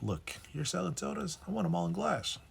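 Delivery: high, lightly voiced demanding official; a hint of a stern lawmaker laying down the rule; mock official voice.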